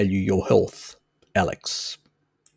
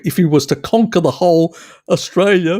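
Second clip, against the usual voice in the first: rising excited outburst; 8 semitones higher, 6 dB louder than usual. Real, not acted. Builds up to joke, laughing.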